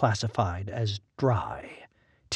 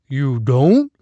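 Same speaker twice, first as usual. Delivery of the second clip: blank, thin voice; 2 semitones higher, 8 dB louder than usual. A narrator's reading. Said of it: "he asked blankly".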